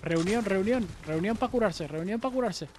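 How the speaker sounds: high, repeated gathering call; rallying call. Real, not acted. Player gathers teammates to heal, high rallying call. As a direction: call the team in, pitched high.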